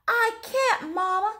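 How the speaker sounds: put-on child pushing back at his mother; pleading boy. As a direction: protest to mama like an upset little boy.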